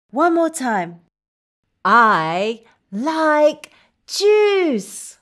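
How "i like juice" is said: very slow, drawn-out delight; slow, emphatic happy declaration; enthusiastic liking. Shown as a demonstration. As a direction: stretch each word happily for kids. Modelling liking juice for children.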